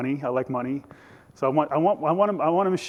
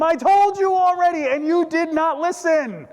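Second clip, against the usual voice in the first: exasperated shout; 14 semitones higher, 6 dB louder than usual. Acted. Healed man answering Pharisees who keep asking, exasperated and loud. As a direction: fire back loudly, stretching out the complaint.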